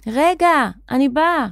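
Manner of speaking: acted hurried shout; calling out. A narrator's reading. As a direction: call ahead to someone, lightly acted. Calling to someone ahead.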